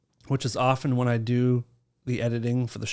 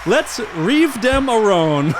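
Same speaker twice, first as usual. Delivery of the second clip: put-on loud, high, drawn-out Chinese accent parody; mock Chinese accent; 7 semitones higher, 7 dB louder, much slower than usual. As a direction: exaggerate a Chinese accent slowly and loudly. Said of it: "The accent all the time".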